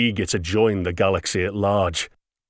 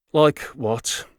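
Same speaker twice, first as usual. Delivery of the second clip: irritated; 7 semitones higher, 3 dB louder than usual. A narrator's reading. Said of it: "unable to totally hide the irritation".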